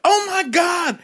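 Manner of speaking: a sudden high shriek of shock; shrieking vagina. Pretend. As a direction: scream it like a startled vagina. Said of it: "your vagina was just like".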